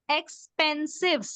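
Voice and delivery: "expensive" slowly as ex, pen, sive; three separate beats on "expensive"; syllable by syllable. Shown as a demonstration. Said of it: "Let's see the syllables".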